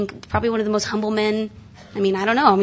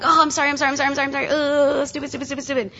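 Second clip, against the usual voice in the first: mock frantic self-reproach; 4 semitones higher, much faster than usual. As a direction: act out beating yourself up. Acting out repeated apology, self-scolding.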